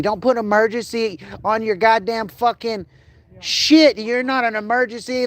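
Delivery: high, peaking into an angry shout; real angry rant. Real, not acted. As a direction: blow up at the clinic. Ranting at vet clinics.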